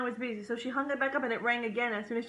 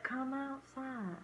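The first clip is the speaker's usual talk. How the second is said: slow, soft creepy lure; creepy coaxing; 2 semitones higher, 10 dB quieter, much slower than usual. Retold or imitated. Priest luring the family out, slow and creepy. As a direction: draw them out slowly, like a ghost. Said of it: "He's like".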